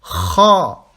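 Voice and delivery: Kha with a roaring scrape in the throat; rasping kha. Shown as a demonstration. Teacher demonstrating Kha, air out with a roar. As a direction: growl out Kha. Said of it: "en rugissant".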